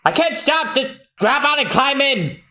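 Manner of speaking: high, shouted warning; high hammed-up shout. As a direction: yell to the people in the water. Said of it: "Zoro just shouts".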